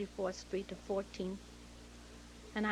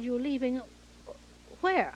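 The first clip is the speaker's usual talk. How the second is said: loud, high startled question; retold astonishment; 5 semitones higher, 7 dB louder than usual. Director hearing his actress is leaving, astonished. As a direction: shoot "where" up high and loud.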